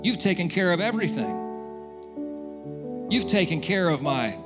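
usual speaking voice

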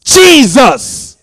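loud, high cry of Jesus; screamed out. Retold or imitated. Crying out to Jesus in a dream, screamed. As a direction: call on Jesus with full force. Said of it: "I screamed out".

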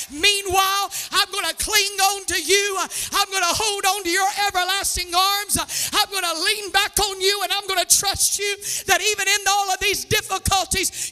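earnest prayer building to a cry; fervent prayer voiced for the congregation. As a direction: plead with God, then declare trust. Voicing a prayer to God.

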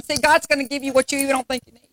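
rising sermon call-and-response; fervent sermon delivery; preaching. Acted. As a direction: preach it to the congregation. Preaching to congregation.

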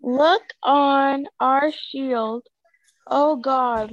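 usual speaking voice

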